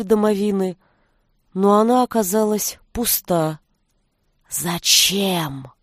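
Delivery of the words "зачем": low hissing snake voice; serpent hiss. Acted. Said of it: "прошипел змеиный голос".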